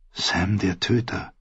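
leise vor sich hin gesprochen; leise, ungläubig. A narrator's reading. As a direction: sag den Beinamen leise für dich. Jon wiederholt den Beinamen nachdenklich. (leises, nachdenkliches Wiederholen)

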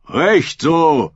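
short cheer of agreement; heckler's shout. A narrator's reading. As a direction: shout approval up from below. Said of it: "rief der rote Ruderer von unten".

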